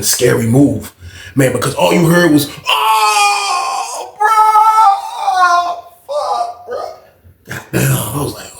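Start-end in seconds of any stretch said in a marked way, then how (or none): 2.7-6.9 very high pained cry out. Retold or imitated. Guy kicked in the nuts, pained cry. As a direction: yelp like you've been kicked.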